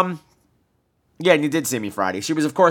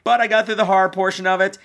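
normal talking voice

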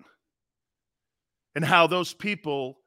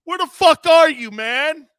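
mock yell; 10 semitones higher, 10 dB louder than usual.

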